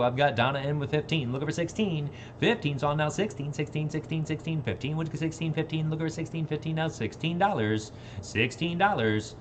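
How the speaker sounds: rhythmic auction chant; auctioneer. Acted. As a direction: rattle off the bids.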